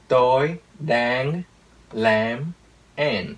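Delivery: put-on slow American accent, every final consonant drawn out; American-accented Vietnamese. Teacher imitates an American speaking Vietnamese, slow American accent. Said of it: "người Mỹ nói nó nghe như thế này".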